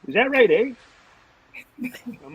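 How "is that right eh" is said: high, loud skeptical coach question; loud, sharply high coach challenge; mock stern coach. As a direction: imitate the coach, pitched way up and loud, doubting you. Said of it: "he'd always go".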